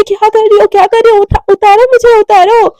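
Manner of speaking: high, loud frantic protest; frantic cries to be put down; panicked yell. Acted. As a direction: squeal while being carried. Mishka carried, panicking.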